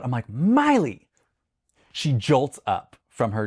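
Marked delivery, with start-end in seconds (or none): 0.3-1.1 high, drawn-out retold call; sharp calling. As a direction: call out to jolt the dog awake. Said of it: "I clap my hands really loud".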